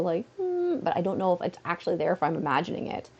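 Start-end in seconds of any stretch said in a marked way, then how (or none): 0.4-0.8 mosquito buzz. Retold or imitated. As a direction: hum the mosquito's whine. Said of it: "I'll still hear the".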